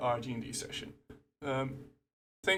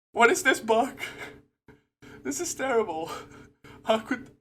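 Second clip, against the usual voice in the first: loud, much higher exasperated cry; emotional outburst; 9 semitones higher, 8 dB louder than usual. Real, not acted. Ranting to viewers about the Teams bug, exasperated outburst. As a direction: vent at the broken software. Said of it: "I'm just getting emotional".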